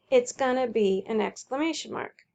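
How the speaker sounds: ordinary delivery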